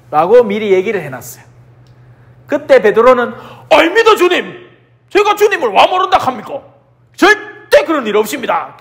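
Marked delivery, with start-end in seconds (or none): 3.7-8.8 higher, earnest protest to his master; Peter protesting. Retold or imitated. Peter swears to Jesus he won't deny him, higher earnest protest. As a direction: insist you'd never deny him.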